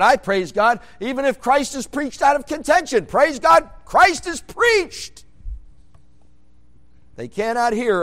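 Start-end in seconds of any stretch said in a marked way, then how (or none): 0.0-5.3 high and loud, slowing on the end; rising preaching. Acted. Preacher quoting Paul to congregation, loud and rising. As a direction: climb to a shout of praise.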